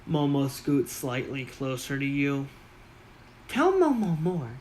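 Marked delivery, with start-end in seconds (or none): 3.5-4.5 high, louder goblin urging; high eager goblin voice. Acted. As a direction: lean in, high and keen.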